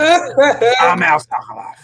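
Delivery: high, slow, hammed-up Boston accent; terrible Boston accent. Host mocks Al from the back office, bad Boston accent. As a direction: stretch it out in an exaggerated Boston accent. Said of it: "they have the worst Massachusetts accent ever".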